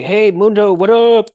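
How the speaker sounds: loud, high casual hello; friendly greeting. Retold or imitated. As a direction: call out hey like a buddy. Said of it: "I want people to stop by and say".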